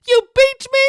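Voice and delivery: high, loud put-on kid voice; James dark moment. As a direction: wail it like a hammy child actor. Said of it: "that's not okay in this scene".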